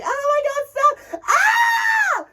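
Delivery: very high mock shriek; screaming high school girls. Girls threatened with thrown coke, mock screaming. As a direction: squeal it like a teenage girl screaming. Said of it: "and they're like".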